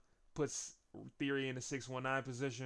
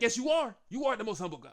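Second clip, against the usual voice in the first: high, loud sarcastic yes; mock shouted agreement; 10 semitones higher, 8 dB louder, faster than usual. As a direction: overdo the praise. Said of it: "Paul's like".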